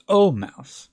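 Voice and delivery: O Mouse with a falling close; vocative O Mouse. A narrator's reading. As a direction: end the declension with a ringing O Mouse. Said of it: "her brother's Latin grammar".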